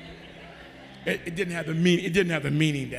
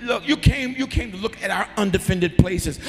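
loud, high, driving preacher voice; preaching in full force; 8 semitones higher, 10 dB louder than usual. Acted. Preacher retelling the brothers' plea, loud and high. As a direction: shout the scripture out to the church.

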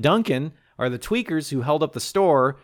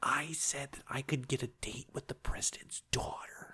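whispered confession, much softer; 16 dB quieter than usual. Acted. Whispered confession of the bet to his date. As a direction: hush it like a guilty secret.

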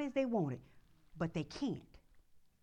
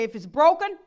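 loud, higher lead line for the church to repeat; call and response; 6 semitones higher, 17 dB louder than usual. Shown as a demonstration. Pastor leading the congregation in a loud chant. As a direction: call it out for them to echo.

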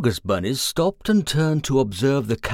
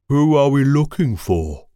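purposeful; 5 dB louder than usual. Acted. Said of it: "said Ballbag in purposeful mode".

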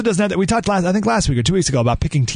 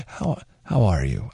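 much lower, softer, slow and heartfelt; trying Glenn's genuine tone; 8 semitones lower, 5 dB quieter, slower than usual. Shown as a demonstration. Host attempting Glenn's greeting, low slow sincerity. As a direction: ask it like you truly care. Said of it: "I can't even fake it".

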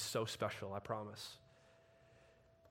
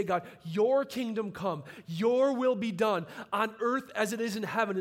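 loud, much higher, slow declaration; proclaimed prayer; 9 semitones higher, 8 dB louder than usual. Acted. Leading the church in the Lord's Prayer, loudly proclaimed. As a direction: cry it out like a banner.

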